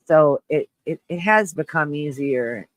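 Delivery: ordinary talk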